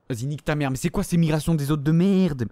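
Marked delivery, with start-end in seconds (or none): none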